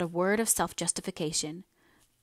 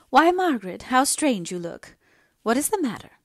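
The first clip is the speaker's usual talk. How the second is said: higher, louder startled questioning; surprised mother; 6 semitones higher, 7 dB louder than usual. A narrator's reading. Mother noticing her daughter's strange look, surprised. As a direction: exclaim it in startled concern.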